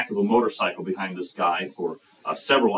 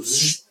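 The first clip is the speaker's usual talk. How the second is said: buzzing like flies scattering; imitated fly buzz; 4 semitones higher than usual. Flies fleeing the hacked meat, imitated buzzing. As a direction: make a short buzz of flies taking off. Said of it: "the flies go".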